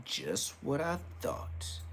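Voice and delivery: whispered, slow, to himself; slow knowing whisper. Real, not acted. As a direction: mutter it under the breath, slowly. Knowing aside.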